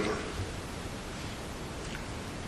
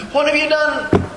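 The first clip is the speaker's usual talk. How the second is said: high, railing cry at the prophet; grieving mother's rage; 11 semitones higher than usual. Retold or imitated. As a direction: hurl the accusation up high. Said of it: "in anger she rails against Elijah".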